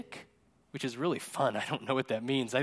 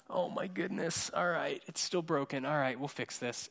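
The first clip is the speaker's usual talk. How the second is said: soft, sighing acceptance; quietly resigned, as if sighing; weary resignation; 8 dB quieter than usual. Retold or imitated. Jesus back from the mountain to a problem, wearily resigned.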